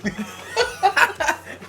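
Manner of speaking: loud high-pitched laugh; high squealing laugh. Real, not acted. Laughs at the repeated dig.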